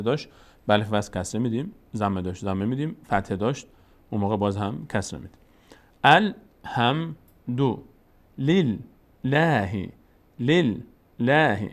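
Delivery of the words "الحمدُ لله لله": very slow الحمدو لله, syllable by syllable; drawn-out teaching model of لله with a light l; thin-l recitation. Shown as a demonstration. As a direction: keep the l of لله light, long aa. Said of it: "اینجا هم لفظ جلاله پر نمیشه".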